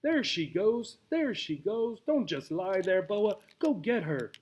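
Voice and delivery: high, eager chatter with a hint of Spanish; excited tarantula. Tarantula urging the snake to chase Obie, excited and high. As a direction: urge the snake on in a frenzy.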